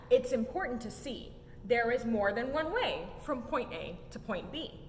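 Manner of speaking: plain reading voice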